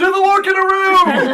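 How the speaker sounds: high, loud, slow chant; crowd cheer. Acted. GM voicing a bystander cheering him on. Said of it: "somebody else goes".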